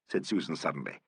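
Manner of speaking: plain reading voice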